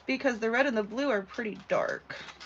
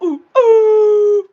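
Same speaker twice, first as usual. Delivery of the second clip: loud, high drawn-out "ooh"; excited whoop; 11 semitones higher, 18 dB louder than usual. Real, not acted. Crafter whooping for a viewer, loud and high.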